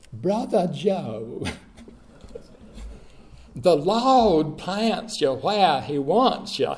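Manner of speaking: put-on slow, drawn-out Southern accent; slow Southern pastor accent, easy and deliberate; deep Southern accent. Old pastor advising the young preacher, slow Southern accent. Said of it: "in a very deep Southern accent".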